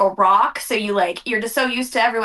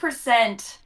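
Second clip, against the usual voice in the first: usual speaking voice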